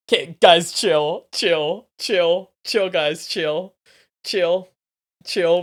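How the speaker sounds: loud repeated calls to chill. Real, not acted. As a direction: call it out over the chat. Calming the chat down.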